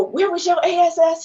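imitating an angry partner yelling across a theater; retold scream. Partner bursts into the dark theater, retold yell. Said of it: "he's yelling".

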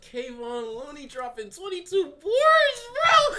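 loud incredulous shout. Real, not acted. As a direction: yell it in disbelief. Heated sports argument, outburst.

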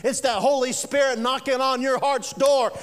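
ordinary talk

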